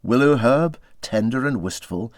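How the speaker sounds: ordinary delivery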